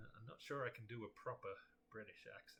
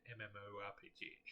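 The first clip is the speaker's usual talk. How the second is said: ordinary delivery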